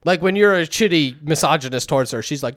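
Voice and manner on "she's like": ordinary talk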